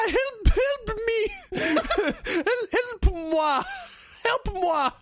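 hammed-up high, slow cries for help, laughing; mock drowning child. Kid begging his father to save his brother. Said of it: "he runs up to his dad and goes".

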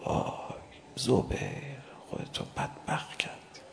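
hushed, whispered lament; sorrowful whisper. Acted. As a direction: whisper it in grief. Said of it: "آقا تأسف".

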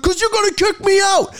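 high, upset mock protest; acted high-pitched outburst; defensive yelling. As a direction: imitate an accusing drunk passenger. Retold upset passenger accusing driver.

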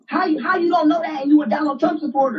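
high-pitched disbelieving challenge; incredulous. Real, not acted. Debater challenges opponent's not knowing, incredulous.

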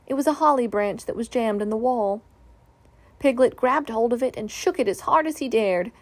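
plain reading voice

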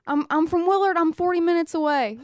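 high, loud insistent self-defense; mock-exasperated local setting someone straight; retold protest. Retold answer to twang question.

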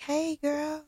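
high, drawn-out friendly hello; high-pitched phone greeting. Retold or imitated.

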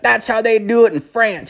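high-pitched insistent comeback; acting out an indignant cook defending himself; retold defensive retort. Cook argues back at the yelling.

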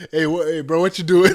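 loud eager bro greeting. Retold or imitated. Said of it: "I'm like".

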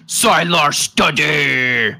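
slow, drawn-out brute voice; simple hulking fighter, stretching each word; armored brute. Acted. Big armored fighter echoing his introduction, slow brute voice. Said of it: "a voice comes out from the".

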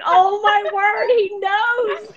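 high, squealing shock at being found out; mock horror. Telling a friend how she reacted, high mock panic. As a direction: gasp it out like a caught fangirl. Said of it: "I was like".

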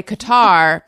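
"Qatar" as kuh-TAR; "Qatar" rhyming with guitar; outdated pronunciation. Shown as a demonstration. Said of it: "open my fat mouth and say".

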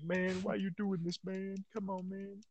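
high, drawn-out whiny pleading; put-on weepy whine; mock crying. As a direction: imitate a tearful man begging, slow and high. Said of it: "and then he starts crying".

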